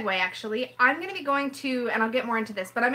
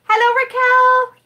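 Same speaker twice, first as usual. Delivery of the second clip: loud, high, drawn-out hello; calling out; 11 semitones higher, 12 dB louder, slower than usual. Real, not acted. Woman greets Raquel mid-argument, loud high call. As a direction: sing out a big greeting.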